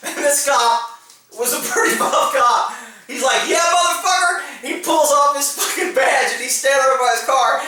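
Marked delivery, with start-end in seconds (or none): 3.5-4.4 higher-pitched retort, imitating a cop; acted tough-cop reply; defiant comeback. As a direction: take up the challenge. Buff cop takes up the challenge.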